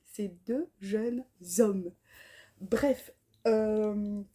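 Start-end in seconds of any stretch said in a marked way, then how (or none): 0.0-1.9 lent, mot par mot après avoir bafouillé; articulé lentement. Real, not acted. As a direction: reprend en détachant chaque mot. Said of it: "je suis fatiguée". (articule enfin lentement)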